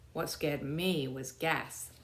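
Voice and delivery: plain reading voice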